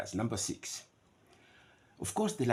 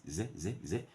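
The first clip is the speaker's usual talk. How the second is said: ordinary talk, nothing marked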